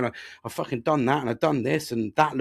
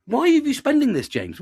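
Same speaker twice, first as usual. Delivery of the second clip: mock wife; 11 semitones higher, 6 dB louder than usual. Wife's question voiced in story.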